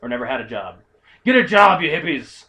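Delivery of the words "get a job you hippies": loud jeering shout at hippies; hammed-up jeer; mock yell. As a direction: yell it like a cranky passerby.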